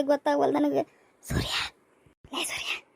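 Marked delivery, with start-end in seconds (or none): 1.3-2.8 hushed, voiceless whisper; furtive whisper. Acted. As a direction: whisper to get a classmate's attention without being caught. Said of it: "ಏನದು ಗುಸು ಗುಸು ಹುಡುಗರು".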